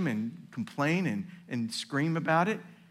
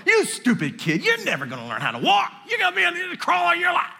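hammed-up loud, fast scolding of the kid; mock scolding; 13 semitones higher, 6 dB louder, faster than usual. Mock parent yelling at a fallen toddler, loud and fast. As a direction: bark at the toddler in mock disgust. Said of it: "You go".

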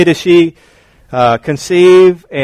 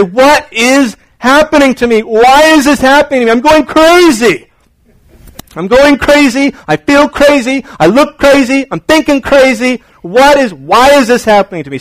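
frantic pregnant woman; 7 semitones higher than usual. Retold or imitated. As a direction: rant like she is going crazy. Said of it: "they cry out to the Lord".

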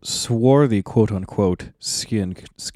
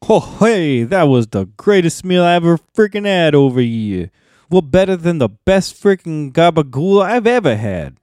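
higher, louder hammed-up East Coast accent; mock East Coast accent; 5 semitones higher, 3 dB louder than usual. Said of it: "East Coast accents in there".

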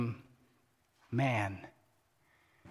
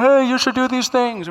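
high, raised voice calling from far off; distant God voice; 7 semitones higher, 5 dB louder than usual. Acted. Preacher mimicking God way over there, distant calling voice. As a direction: boom it out like a far-away God. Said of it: "What's that voice kinda bouncing around the room".

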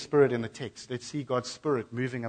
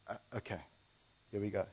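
much softer, lower, hesitant; nervous start; 5 semitones lower, 12 dB quieter, much faster than usual. Retold or imitated. Nicodemus nervously bracing to speak up, hushed and hesitant.

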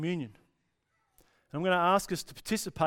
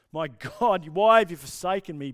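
loud retold cry to God; 9 dB louder than usual. Preacher repeats the dying cry of Jesus, crying out. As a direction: throw the question up to heaven. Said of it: "He cries out in his dying".

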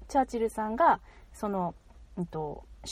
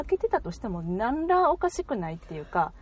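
normal talking voice

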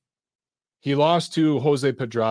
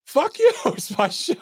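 mock outburst. Host mocking his own reply to the caller, laughing mock yell. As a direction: yell it in jest, then crack up laughing. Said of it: "I'm like".

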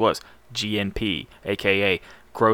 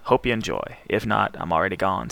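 usual speaking voice

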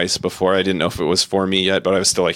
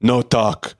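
lightly voiced terse drinker, a bit higher; a hint of a blunt drunk musician; 4 semitones higher, much slower than usual. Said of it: "the guy from Catatonia was just all".

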